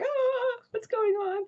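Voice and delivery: very high excited dog voice; hyper dog voice. Pretend. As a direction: squeal it like a bouncing hyper dog. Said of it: "he'd be like".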